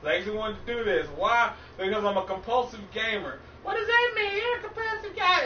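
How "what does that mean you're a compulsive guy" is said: higher, put-on questioning voice; mock questioner. Gamer voicing a friend mocking his gaming habit, high mock voice. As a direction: play the puzzled friend.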